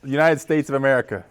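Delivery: normal talking voice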